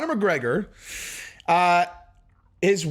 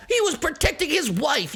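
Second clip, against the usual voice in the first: much higher mock-earnest defender voice; 10 semitones higher than usual. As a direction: parody a smug defender. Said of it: "half the people are all".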